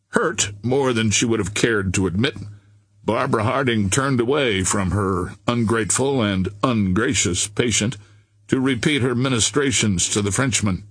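plain reading voice

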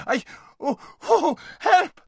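high, loud startled yelp; panicked cry. A narrator's reading. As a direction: cry out in sudden fright. Horse startled by lion's whiskers.

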